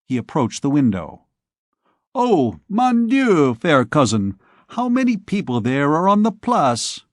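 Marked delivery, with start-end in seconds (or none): 2.1-7.0 exclaiming. Acted. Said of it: "he exclaimed, for the sake of changing the conversation".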